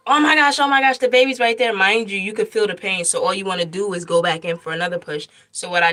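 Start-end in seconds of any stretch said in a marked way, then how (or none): 0.1-1.7 high, loud thrilled "oh my gosh"; retold nurse, amazed that the baby is right there; excited nurse. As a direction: exclaim it like the nurse seeing the head. Nurse sees the baby crowning.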